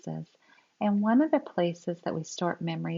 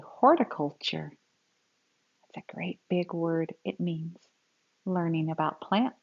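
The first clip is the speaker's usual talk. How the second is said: put-on lively, expressive read-aloud for kids; storybook reading; 4 dB louder than usual. Teacher reading a grandpa story to children, expressive read-aloud. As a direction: read it like a picture book to a class.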